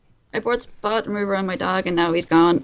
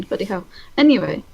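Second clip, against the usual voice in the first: ordinary talk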